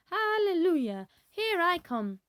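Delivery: put-on high, loud, cackling old woman's cry; gleeful shouting. A narrator's reading. Grandma shouting as she bursts through. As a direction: crow it out in triumph. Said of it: "she shouted".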